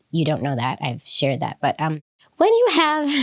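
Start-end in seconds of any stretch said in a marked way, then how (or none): 2.4-3.2 higher pitch, tipping into a laugh; breaking into laughter. Real, not acted. Host telling listeners about partner's chemotherapy, laughing. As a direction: start the thought and crack up laughing. Laughing while sharing wife's illness.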